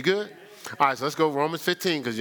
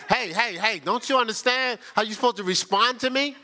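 higher, huffy imitation of an offended member; a hint of haughty outrage; indignant mock; 8 semitones higher than usual. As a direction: play the miffed churchgoer demanding attention. Mocking offended churchgoer.

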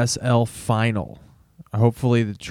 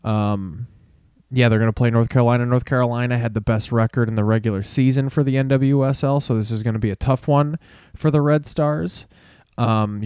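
normal talking voice

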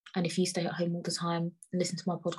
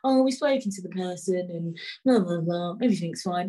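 mock company line. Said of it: "What's T F L gonna say?".

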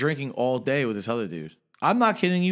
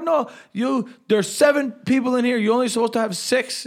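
high-pitched irate driver rant; worked-up mock tirade, pitched well up; retold angry yelling; 10 semitones higher, 4 dB louder than usual. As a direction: imitate an outraged driver yelling. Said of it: "starts yelling at me".

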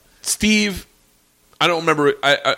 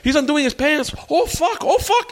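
high squealing mock girl, rising; hammed-up shocked girl on the mic; mock girl squealing, pitch climbing; excited mock girl; 11 semitones higher than usual.

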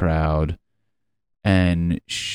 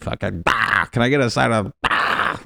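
Tony Robbins impression; 4 semitones higher than usual. Mocking Tony Robbins to listeners, hammed-up guru grunt. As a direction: ham up a pumped-up self-help guru. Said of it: "his would be like".

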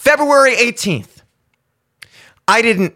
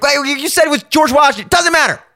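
mock indignant parent; 7 semitones higher than usual. Parent catching the contradiction.

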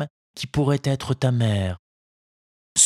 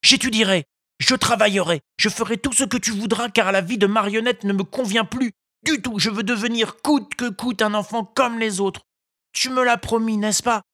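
Pinocchio vows to the fairy; eager puppet boy; 10 semitones higher than usual. A narrator's reading. (Pinocchio promet avec ardeur, voix aiguë)

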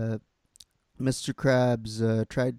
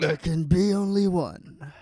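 higher-pitched movie villain quote; Kurgan; 6 semitones higher, 4 dB louder than usual. Acted. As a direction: proclaim it like the Kurgan. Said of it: "He is the Kurgan".

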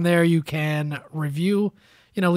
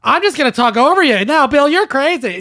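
loud, high dismissive pundit voice; mocking Steve Moore; 8 semitones higher, 7 dB louder than usual. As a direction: talk over them, smug and loud. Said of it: "that's Steve Moore. All right, he just".

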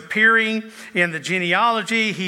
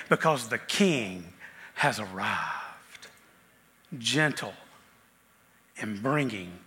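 much lower and softer, slow and gentle; hushed tender; 7 semitones lower, 7 dB quieter, slower than usual. Acted. Pastor softly assuring the congregation of peace, tender hush. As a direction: let it sink in softly, almost a hush.

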